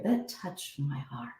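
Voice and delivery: very soft, slow and tender; hushed, heartfelt, slow; touched heart. Shown as a demonstration. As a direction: breathe it from the chest. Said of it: "a sense of warmth that you feel here".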